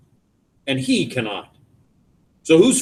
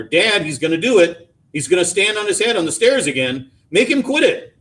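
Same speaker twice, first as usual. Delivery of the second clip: high, raised tattling voice; hollering; 7 semitones higher, 4 dB louder than usual. A narrator's reading. Brother calls Dad to stop the headstand, hollering. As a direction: call out to Dad, climbing higher to the end. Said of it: "Buck would holler".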